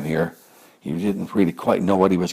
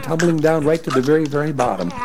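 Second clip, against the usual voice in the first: usual speaking voice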